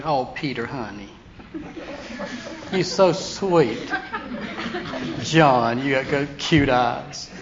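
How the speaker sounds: a lightly voiced mock gushing, with laughs through it; mock sweet talk. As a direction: coo at them like a soap opera sweetheart, half laughing. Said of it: "He didn't go over and say".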